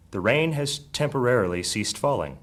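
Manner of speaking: usual speaking voice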